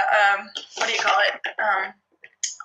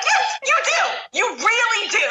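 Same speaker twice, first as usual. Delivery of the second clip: smug vindication; 9 semitones higher, slower than usual. Retold or imitated. Singer admits he owes apology.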